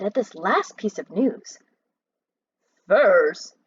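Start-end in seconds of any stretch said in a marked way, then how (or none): 2.9-3.5 loud, astonished Furs? with falling tune; cried out. Acted. Mac cries out at the news, loud and astonished. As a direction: blurt Furs? out in disbelief. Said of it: "he cried out".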